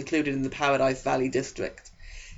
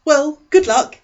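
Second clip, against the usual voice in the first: put-on higher, louder eager teen boy voice; eager Eric; 13 semitones higher, 9 dB louder, slower than usual. Eric leaning over to the new girl, eager and high. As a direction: pitch him up high and keen, a chess-club type.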